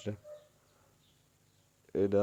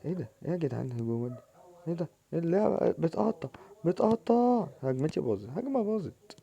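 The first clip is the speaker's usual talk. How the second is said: frustrated complaint; 7 semitones higher than usual. Real, not acted. Game lags, attack ruined.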